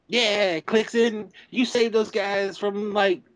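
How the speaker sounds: put-on high excited kid voice; playing a child watching cartoons. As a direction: cheer on like a little boy. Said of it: "That's my kid voice".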